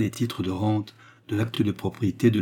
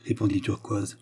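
plain reading voice

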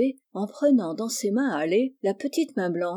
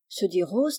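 plain reading voice

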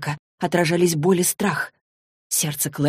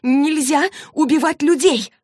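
high, loud, drawn-out outcry; gasping protest; 10 semitones higher, 5 dB louder than usual. Acted. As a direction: cry out in shocked protest, stretching the words. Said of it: "Клэри аж задохнулась". (возмущённый высокий возглас, задыхаясь)

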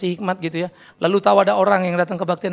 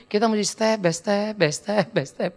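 slow, fading step by step; 6 dB quieter, slower than usual. Shown as a demonstration. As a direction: let each step get softer. Slowing down step by step. (makin pelan dan lirih)

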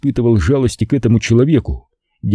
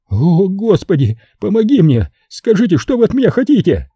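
high, frantic begging pimp voice; a terrified bar owner offering all his money; panicked pimp pleading; 9 semitones higher than usual. Acted. Terrified Simon begging the killer to take the money, high and frantic.

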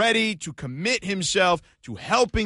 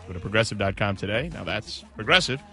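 ad read; 4 semitones lower, 4 dB quieter than usual. Acted. Host reads sponsor ad.

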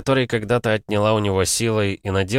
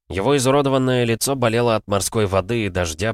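ordinary delivery, nothing marked

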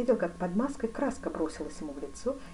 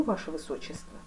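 usual speaking voice

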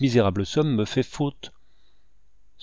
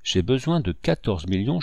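usual speaking voice

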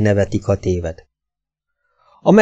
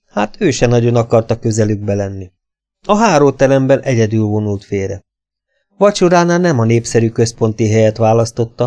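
ordinary delivery

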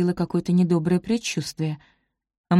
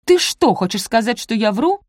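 higher, indignant challenge; 4 semitones higher, 4 dB louder than usual. A narrator's reading. Элоиза challenges her sister for doubting her word, indignant. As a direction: start high and loud, affronted. Sisters squabble, accused of lying.

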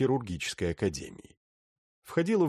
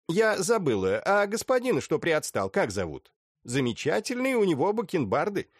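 coy lady voice; 9 semitones higher than usual. Acted. Sukhovskaya asks coyly.

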